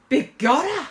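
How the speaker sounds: astonished stage-Irish cry; shocked Irish exclamation. Acted. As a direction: exclaim it in amazement, Irish accent.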